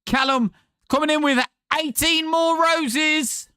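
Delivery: high, drawn-out announcer call; slow, soaring hype-man shout. Pretend. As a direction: sing out the news at a very high pitch. Hyping a viewer's gift.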